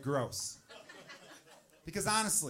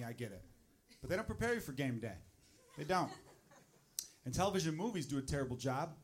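ordinary talk, nothing marked

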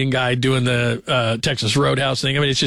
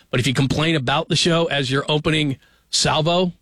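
ordinary talk

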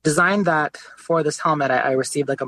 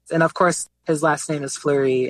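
normal talking voice

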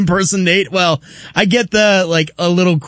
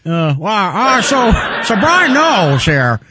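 mock broadcaster intro, barely holding a laugh; Colin Cowherd impression. Co-host doing his bad Cowherd impression for the host. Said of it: "that's his Cowherd right there".

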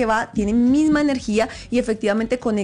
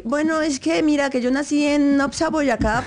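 fake Spanish accent; 3 semitones higher than usual. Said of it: "empiezan a hablar con acento español".

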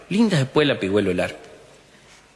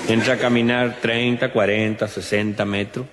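normal talking voice

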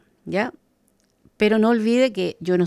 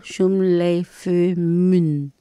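"Shum lei fui mun" spoken very slowly, one syllable at a time; slow careful demo; much slower than usual. Shown as a demonstration. Teacher says the plural greeting slowly so listeners can repeat it. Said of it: "por el sonido".

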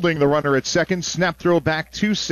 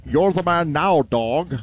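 put-on Scottish accent. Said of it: "in a Scottish accent".

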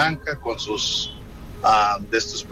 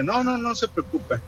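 high soothing nurse; 10 semitones higher, 4 dB quieter than usual. Retold or imitated. Nurse voiced reassuring the mother.

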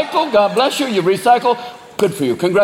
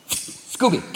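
sly cat whisper; 7 dB quieter than usual. Acted. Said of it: "the cat's like".

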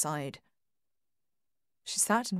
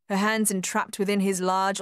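ordinary delivery, nothing marked

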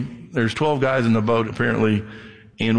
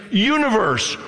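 commanding word; 8 semitones higher, slower than usual. Pretend. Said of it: "All he's gotta do is say".

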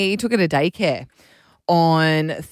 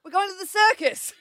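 mock correction; 9 semitones higher than usual. Mum correcting toddler, retold.

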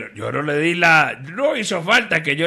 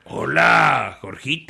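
boss in a joke; 2 semitones lower, slower than usual. Acted. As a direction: greet the sidekick slowly and ponderously. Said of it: "le dice al otro". (saludo pausado de jefe)

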